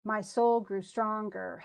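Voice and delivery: "My soul grew stronger" slow, beat by beat; scanning the meter. Shown as a demonstration. Teacher to student, reading the line slowly to show the meter. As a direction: read it slow, marking each beat. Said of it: "the present is stressed".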